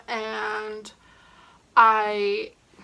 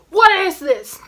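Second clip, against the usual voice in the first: sharp, loud and much higher demand; angry dad recreation; 9 semitones higher, 12 dB louder than usual. Retold or imitated. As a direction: bark the question like a furious father. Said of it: "let me do a recreation".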